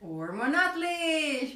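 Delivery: ordinary talk, nothing marked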